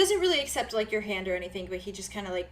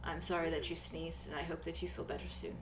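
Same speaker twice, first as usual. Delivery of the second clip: soft, earnest; 3 semitones lower, 9 dB quieter than usual. Acted. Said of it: "he's like".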